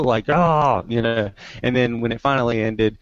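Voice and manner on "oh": higher mock groan of dismay; imitated group moan. Whole audience at the blackout, moaning. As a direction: groan like a disappointed crowd. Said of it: "everybody moaned".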